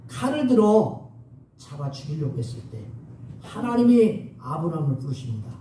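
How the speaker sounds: normal talking voice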